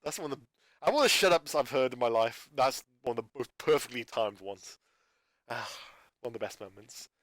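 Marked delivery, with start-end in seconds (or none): none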